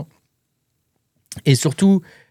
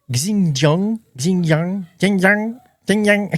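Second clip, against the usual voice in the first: "Xinjiang" repeated in a self-mocking Chinese accent, pitch climbing higher with each try; bad Chinese accent; 6 semitones higher, slower than usual. Shown as a demonstration. Apologizing to viewers for his bad Chinese accent, rising mock Chinese "Xinjiang". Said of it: "pardonnez-moi pour mon mauvais accent chinois".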